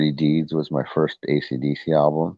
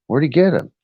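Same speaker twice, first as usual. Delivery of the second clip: high, loud startled question; suddenly alarmed at the news; retold surprise; 11 semitones higher, 5 dB louder than usual. Husband asking his wife where the cat came from, high startled question. Retelling shock at new cat.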